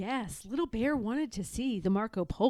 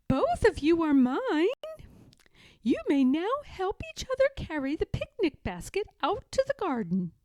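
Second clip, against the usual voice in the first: grandmother bear voice; 9 semitones higher, 4 dB louder than usual. A narrator's reading. Grandmother character voice.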